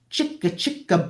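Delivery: ordinary talk, nothing marked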